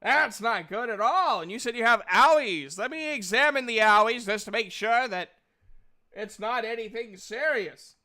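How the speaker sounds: loud, swooping character voice; big hammed-up fussing over a patient; concerned game character. Game master's character examines a player's wounds, loudly concerned.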